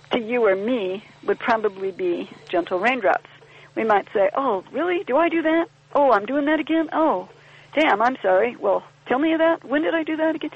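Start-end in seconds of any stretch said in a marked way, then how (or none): 4.3-10.5 lilting higher, surprised and apologetic; curious surprise. Pretend. Member answering group feedback, higher and pleasantly surprised. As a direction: sound genuinely curious about your own fault.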